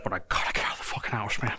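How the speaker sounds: fast, soft, breathy mutter. Acted. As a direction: grumble it under your breath. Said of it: "character venting in voice".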